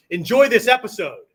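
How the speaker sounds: loud, high, firm imperative, "Enjoy" hit hard; command. Shown as a demonstration. Teacher demos the command inflection to the class, loud and firm. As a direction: order them to "Enjoy this episode". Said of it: "now it's a command".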